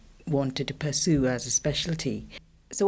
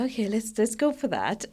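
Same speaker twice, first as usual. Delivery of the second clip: higher, brighter quoted self going for it; upbeat decision; 8 semitones higher than usual. Retold or imitated. Guest recalls deciding on the Harvard fellowship, quoting herself upbeat and higher. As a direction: chirp it like a sudden easy yes. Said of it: "I was like".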